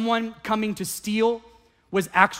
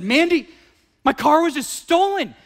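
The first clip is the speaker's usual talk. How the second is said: high, urgent phone call to his wife; husband telling wife the car is stolen, alarmed; retold alarm; 8 semitones higher, 4 dB louder than usual. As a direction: blurt it out in a panic. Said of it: "and I said".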